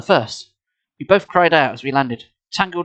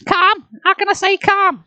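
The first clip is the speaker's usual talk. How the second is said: put-on very high, shrill girl voice; shrill indignant girl voice; shrill demand. A narrator's reading. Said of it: "she demanded, shrilly".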